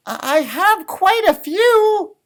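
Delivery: high, slow little rabbit voice; a timid rabbit asking, drawn out; Rabbit's voice. Acted. Reading to a child, high slow Rabbit voice.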